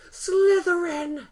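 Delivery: loud, high, drawn-out announcement; booming hat proclamation; hat shouting. Pretend. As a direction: belt out the house name like the sorting hat. Sorting hat shouting house name.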